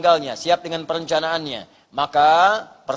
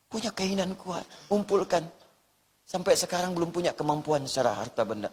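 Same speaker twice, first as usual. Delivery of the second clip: doa lirih ditirukan; 9 dB quieter than usual. Retold or imitated. Meniru doa jamaah yang menangis.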